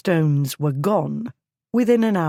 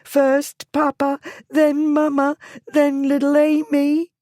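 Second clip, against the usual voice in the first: high, louder anguished lament; tearful cry; 11 semitones higher, 4 dB louder than usual. A narrator's reading. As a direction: cry out the losses one by one. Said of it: "Hannah cried".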